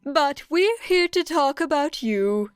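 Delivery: chirpy high-voiced principal explaining. A narrator's reading. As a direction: pitch it up like a small cheerful creature. Nezu voiced by narrator.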